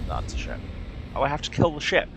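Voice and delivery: ordinary talk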